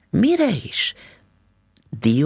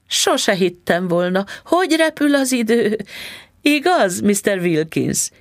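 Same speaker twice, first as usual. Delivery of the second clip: put-on higher, sugary old lady voice; a hint of a doting old woman; sweet old landlady; 8 semitones higher, 3 dB louder than usual. A narrator's reading. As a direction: coo like a kindly old landlady.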